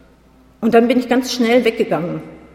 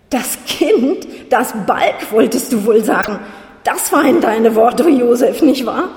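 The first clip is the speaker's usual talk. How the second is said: screamed; 3 semitones higher, 6 dB louder, slower than usual. A narrator's reading. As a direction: scream it into his face. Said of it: "geschrien hab ich's wohl eher". (laut und hoch geschrien)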